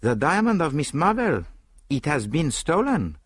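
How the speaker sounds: put-on high, French-accented announcement; agitated French-accented detective; Poirot, half ashamed, half excited. A narrator's reading. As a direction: break the news high and emphatic, slowing at the end. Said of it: "He looked half ashamed, half excited".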